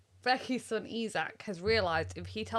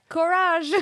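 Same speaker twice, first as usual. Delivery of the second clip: put-on cheering boy; 8 semitones higher, 9 dB louder than usual. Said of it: "her little boy Isaac was like".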